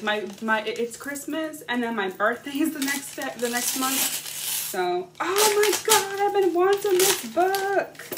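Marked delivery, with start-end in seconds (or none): 5.2-8.0 much higher and louder, slowing on the book; thrilled gush. Real, not acted. Thrilled shout as she unwraps the book from her friend. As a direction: squeal over the book she's wanted.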